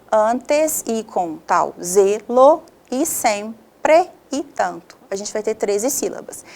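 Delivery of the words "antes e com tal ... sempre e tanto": very slow, syllables separated by pauses; slow teacherly dictation, piece by piece; syllable-by-syllable division. Shown as a demonstration. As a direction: chop each word into its grammatical syllables. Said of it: "a divisão por sílabas gramaticais".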